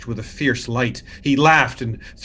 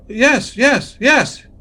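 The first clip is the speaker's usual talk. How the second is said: much higher, louder eager yeses; insistent; 12 semitones higher, 8 dB louder, much slower than usual. Acted. Montresor insisting to Fortunato he is a Mason, high and loud. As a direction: insist over and over, pitch climbing.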